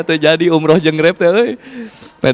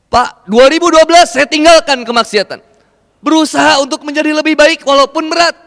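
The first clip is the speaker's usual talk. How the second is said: raised, ringing sermon voice; fervent preaching; 7 semitones higher than usual. Acted. Preacher urging the congregation to trust God's provision, fervent. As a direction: preach it high and full to the crowd.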